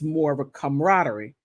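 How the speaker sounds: normal talking voice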